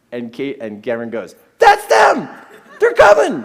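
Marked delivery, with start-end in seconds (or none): 1.6-3.4 put-on very high, loud boyish yell; excited child's shout. Son hearing rescuers coming, thrilled high shout. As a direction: shriek it like a thrilled little boy.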